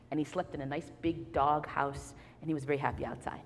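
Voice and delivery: usual speaking voice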